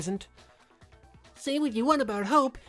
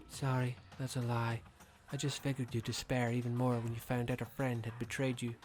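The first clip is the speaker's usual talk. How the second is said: cold and quiet; 6 semitones lower, 8 dB quieter than usual. Acted. Villain drops the act.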